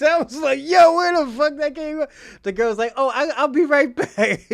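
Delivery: high, loud, laughing through the quotes; loud laughing retelling. Real, not acted. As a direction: crack up while telling it.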